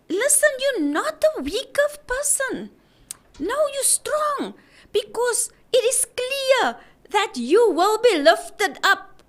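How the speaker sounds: high, breaking voice, urging her on; earnest plea near tears. Real, not acted. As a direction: fight back tears while you plead she is strong. Pastor urging wife, voice breaking.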